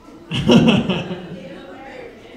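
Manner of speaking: breathy run of chuckles; laugh. Real, not acted. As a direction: let out a rolling chuckle. Laughs during own bit.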